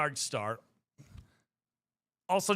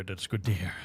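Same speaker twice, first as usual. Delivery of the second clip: repeated much lower and softer; deep voice; 11 semitones lower, 5 dB quieter than usual. Shown as a demonstration. Streamer repeats her reply to chat in a low voice. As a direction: drop into a low goofy voice.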